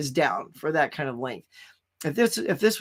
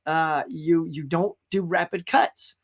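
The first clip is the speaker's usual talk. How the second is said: ordinary talk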